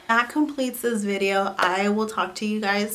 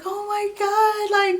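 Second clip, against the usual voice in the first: high, slow, drawn-out gasp of joy; imitating a friend's delighted squeal, stretched out; slow, high retold exclamation; excited; 12 semitones higher, much slower than usual. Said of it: "she was so excited".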